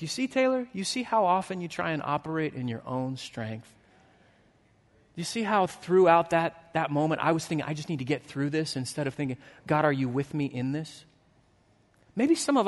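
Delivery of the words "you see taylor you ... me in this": soft, gentle voice of God correcting; lightly voiced God, quietly teaching. God gently correcting the weary pastor, softer and lower. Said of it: "God just hit me upside the head".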